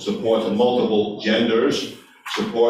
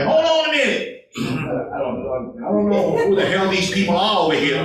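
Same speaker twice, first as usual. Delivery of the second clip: put-on Southern accent; 6 semitones higher than usual. Man rejecting the left side, high mock Southern accent. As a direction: play the Southern-raised man bristling. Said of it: "he actually had a Southern accent".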